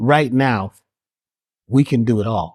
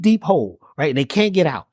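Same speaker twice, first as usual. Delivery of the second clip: ordinary talk, nothing marked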